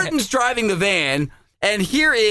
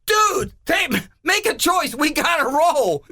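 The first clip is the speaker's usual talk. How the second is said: high-pitched urgent plea; acted impatience; 7 semitones higher, slower than usual. Grandma to little boy as the van moves ahead, impatient. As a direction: hurry a dawdling kid along, pitched up high. Hurrying a slow child along.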